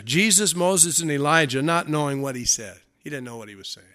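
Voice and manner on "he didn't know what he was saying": usual speaking voice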